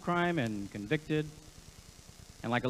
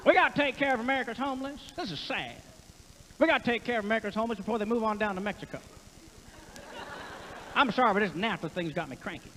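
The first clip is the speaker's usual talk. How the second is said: higher, quick Texas twang; Ross Perot; 4 semitones higher, faster than usual. Acted. Comic doing Perot on homelessness, fast high twang. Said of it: "Ross was easy to get".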